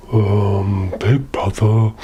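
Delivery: low, pondering troll voice; troll thinking. Acted. Silly troll considers the goat's offer, low pondering voice. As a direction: mull it over as a silly troll. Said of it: "he thought".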